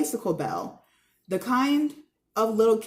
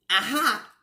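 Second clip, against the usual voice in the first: triumphant aha. Acted. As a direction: crow it like a trivia winner.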